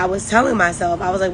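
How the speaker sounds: ordinary delivery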